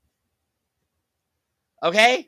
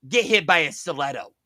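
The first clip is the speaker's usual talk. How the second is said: normal talking voice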